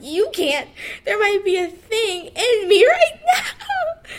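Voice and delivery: mock the panic, half laughing half crying; panicked half-laugh. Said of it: "And I'm like".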